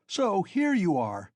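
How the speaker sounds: high, loud, slow angry shout. A narrator's reading. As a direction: shout it at the top of your voice. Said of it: "he shouted at the top of his voice".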